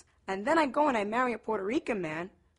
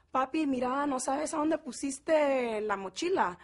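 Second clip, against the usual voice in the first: put-on Guatemalan Spanish accent; 4 semitones higher than usual. Asking papi about the backpack, Guatemalan Spanish accent. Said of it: "two completely different types of Spanish".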